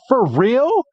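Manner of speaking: high-pitched mock disbelief, swooping up at the end; play-acted astonishment; incredulous. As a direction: act out an amazed kid's question. Iris amazed at the news.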